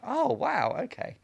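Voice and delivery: mock amazed, high and loud then dropping; impressed surprise. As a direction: imitate casting people suddenly won over. Voices impressed casting people's reaction.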